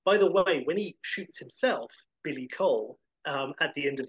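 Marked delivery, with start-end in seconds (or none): none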